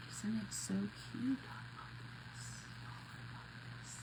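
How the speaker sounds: hushed, breathy gush over the ornament; soft whisper. Real, not acted. Crafter whispering to viewers over the Santa jacket, hushed adoring whisper. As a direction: whisper it as if the cuteness could break.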